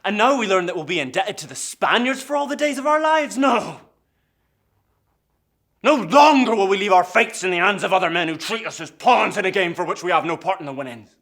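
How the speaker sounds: full-force, high-pitched shouting; furious rallying cry. Acted. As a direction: roar your defiance at the injustice. Rebellious outburst against debt.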